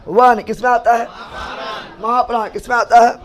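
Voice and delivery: calling the question out to the whole class; loud, push the class to answer; loud drill question. Real, not acted. Teacher loudly quizzing the class, loud call-out.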